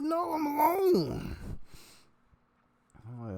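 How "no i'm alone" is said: loud, high, drawn-out mock wail. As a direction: wail it slowly like a hammed-up crybaby. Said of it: "he just started crying in his hands".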